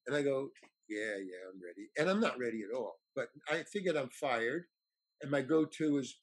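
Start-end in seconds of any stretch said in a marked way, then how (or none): 0.9-1.9 low, very quiet mumbled yes; reluctant agreement. Retold or imitated. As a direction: agree weakly, voice dropping away. Not ready, feigns agreeing to director.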